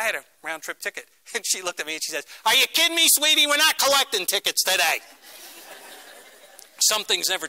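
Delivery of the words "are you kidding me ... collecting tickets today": loud, high New York squawk, imitated; New York accent. Amtrak woman refusing a traveler's ticket, brash New York accent. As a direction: holler it like an incredulous New York ticket agent. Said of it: "in her finest New York accent".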